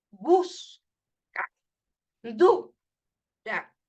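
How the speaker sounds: "Busca", "Dura", each broken after a strong first syllable; slow syllable-by-syllable "Busca. Dura."; stressed syllables. Shown as a demonstration. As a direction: punch BUS and DU, leave a gap, drop "ca" and "ra". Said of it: "que suena fuerte".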